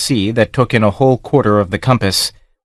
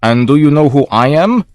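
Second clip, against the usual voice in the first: louder, rising challenge; demanding captain; 5 semitones higher, 4 dB louder than usual. A narrator's reading. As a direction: challenge him with proud menace. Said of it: "his questioner demanded".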